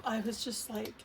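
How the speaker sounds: ordinary delivery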